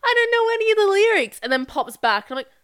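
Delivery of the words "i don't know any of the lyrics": high, loud mock yell; imitating annoying random guy. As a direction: put on a high voice to mimic him. Imitates random guy in her ear.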